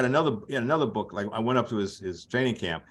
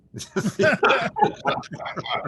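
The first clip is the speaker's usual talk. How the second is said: high, airy burst of laughter; amused laugh; 4 dB louder than usual. Real, not acted. Storyteller laughing at Ali's prank, amused. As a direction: crack up after the punchline.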